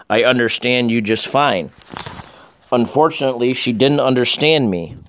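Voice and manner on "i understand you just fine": plain reading voice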